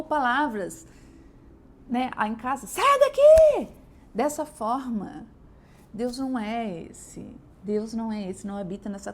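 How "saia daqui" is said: high, loud, slow yell; mother shouting at someone at home; screamed order. Retold or imitated. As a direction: bark the order out.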